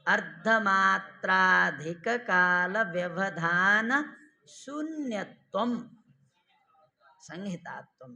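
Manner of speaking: very slow, syllable by syllable recitation; sutra recitation. Shown as a demonstration. Teacher reciting the definition slowly for students. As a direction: spell out the Sanskrit definition slowly.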